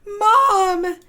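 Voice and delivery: high, loud yell for mom; excited child screams for mom, high and loud. Acted. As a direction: call out in triumph. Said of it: "screamed Elda".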